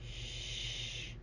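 soft breathy shush, barely voiced; whispered shush. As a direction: hush the listener gently. Hushing refrain.

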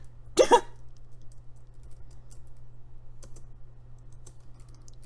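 high unvoiced villain giggle; evil villain cackle. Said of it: "evil villain laughter".

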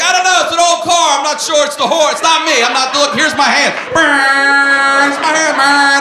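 high, loud defensive shouting; flustered dad shouting his excuses; frantic protest. Acted. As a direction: plead innocence at top volume. Acts dad pleading with driver.

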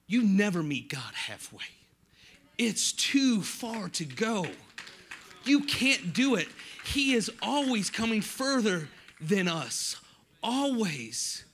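loud, high, emphatic preaching; forceful preaching. Real, not acted. Preacher telling the congregation God always comes further, full-force preaching.

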